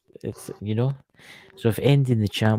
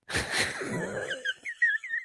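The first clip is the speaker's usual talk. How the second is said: long high-pitched laugh; real laughter breaking out high; 7 dB quieter than usual. Real, not acted. Hosts joke about the changing future, laughing. Banter about buying women.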